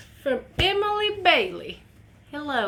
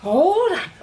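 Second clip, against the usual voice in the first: excited hold on; 7 semitones higher, 10 dB louder than usual. Real, not acted. Overwhelmed by tapestry.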